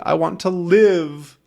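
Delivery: high, drawn-out plea; pleading for life. Acted. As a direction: plead slowly in a lifted voice. Acting out fear of dying.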